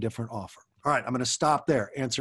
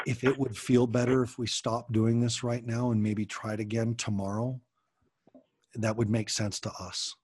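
put-on a softer, lower voice that soothes a hurting child; soft, gentle tone; 2 semitones lower, 3 dB quieter than usual. Shown as a demonstration. Parent calming a shut-down adopted daughter, soft and gentle. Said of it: "using this low tone of voice and being really soft".

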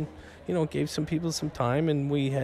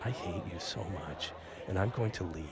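low, very soft deadpan; mock disgust; 7 semitones lower, 13 dB quieter, faster than usual. Host answering co-host's George Clooney guess, deadpan disgust. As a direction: quietly pretend to be done with them.

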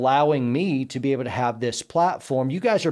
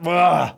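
louder straining ugh; strained grunt; 3 semitones higher, 6 dB louder than usual. Retold or imitated. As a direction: groan under a heavy load. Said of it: "it's kinda like".

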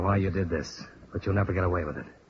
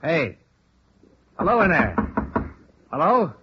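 raised, higher call through a closed door; 8 semitones higher than usual. Acted. Investigator calls into the silent house, calling out. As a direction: call to someone inside who won't answer.

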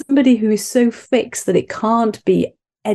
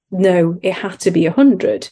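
normal talking voice

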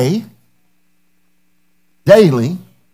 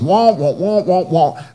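muffled wah wah talk; slower than usual. Pretend. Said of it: "Everything I'm saying is just noise".

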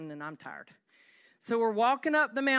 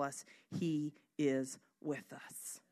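whispered; 5 semitones lower, 9 dB quieter, slower than usual. Acted. Whispering the sermon's key line.

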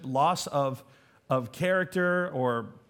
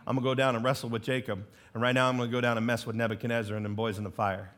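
lower, fast, casual son's voice; playful lower voice of a son making plans; Jesus talking to his Father; 7 semitones lower, faster than usual. Retold or imitated. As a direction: toss it off like a cheeky son to his dad.

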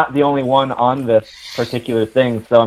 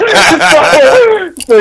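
laughing; 4 dB louder than usual. Real, not acted. Laughing at own retort.

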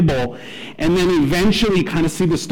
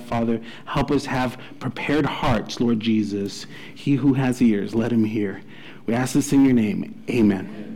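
hushed, lower prayer voice; 4 semitones lower than usual. Real, not acted. Pastor praying over the congregation, hushed and lower.

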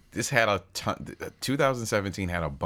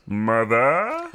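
dramatic, drawn-out title reading, louder at the name; 2 semitones higher, 5 dB louder than usual. Acted. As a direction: announce the title with slow, exaggerated drama. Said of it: "that's your dramatic reading of the title".

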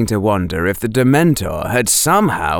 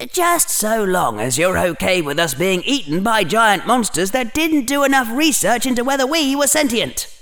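lightly voiced Harry, higher than the narration; Harry, exasperated; 10 semitones higher than usual. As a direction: object in mild exasperation, picking up speed. Harry answers his inner voices.

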